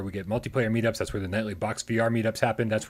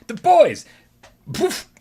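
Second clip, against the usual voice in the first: excited shout; 14 semitones higher, 9 dB louder, much slower than usual. Real, not acted. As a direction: burst out with excitement. Hyped introduction outburst.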